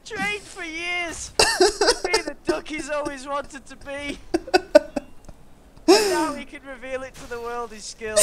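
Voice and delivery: high, loud words through laughing; real hysterics squeezing the voice high; helpless laughter. Real, not acted. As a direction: struggle to speak while cracking up. Helpless laughter after duck impression.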